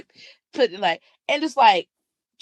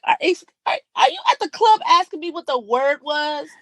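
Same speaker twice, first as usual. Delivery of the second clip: high, outraged disbelief; are you serious, in the club; incredulous retort; 6 semitones higher than usual. Retold or imitated. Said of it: "I was like".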